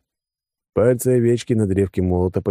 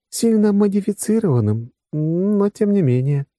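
high-pitched female character voice; qualify it in a raised woman's voice; explaining woman; 14 semitones higher than usual. Acted. Woman explaining modified bodies to the men, high voice.

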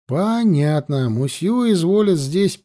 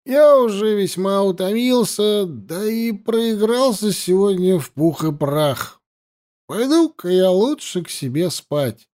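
tipsy guest; 6 semitones higher than usual. A narrator's reading. Drunk gambler leaving for bed, tipsy and high-pitched. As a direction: play the swaying gambler bowing out, pitched up. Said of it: "сильно покачиваясь".

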